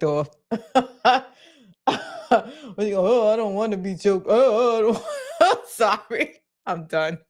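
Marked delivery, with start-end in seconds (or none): 3.1-5.7 mock gargled choking voice; gargling. Host mimics the woman on the audio, gargling. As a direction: imitate her talking through a gargle, half laughing. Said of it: "sounded like she's gargling".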